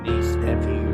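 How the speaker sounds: normal talking voice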